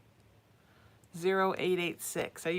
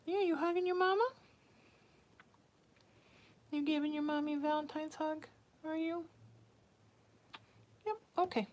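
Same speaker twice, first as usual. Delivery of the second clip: much higher cooing, quick; 8 semitones higher than usual. Real, not acted. Mom coos to the cat hugging her neck, high baby talk. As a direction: coo up high at the cuddling cat.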